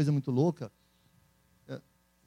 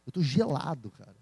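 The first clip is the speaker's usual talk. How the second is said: ordinary delivery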